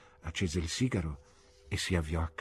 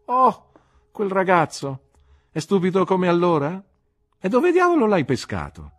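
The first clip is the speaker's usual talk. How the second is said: loud, much higher mocking disbelief; scornful Steerforth; 12 semitones higher, 9 dB louder than usual. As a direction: sneer at the dull old schoolmate.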